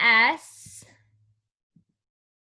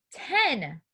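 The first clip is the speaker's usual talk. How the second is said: ordinary delivery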